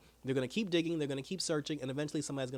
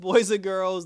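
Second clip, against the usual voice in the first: laughing; 4 semitones higher, 10 dB louder, slower than usual. Real, not acted. Laughing aside to audience.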